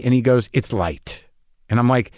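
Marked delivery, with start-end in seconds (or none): 0.5-1.2 much lower and softer, dry and offhand; George Clooney's dry aside. Retold or imitated. The star shrugging off his own movie, low and quiet. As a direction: murmur it like a modest star.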